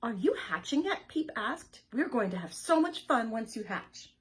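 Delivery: loud, eager chick; loud eager little chick; excited chick. Acted. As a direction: coax the egg eagerly. Peep voiced eagerly.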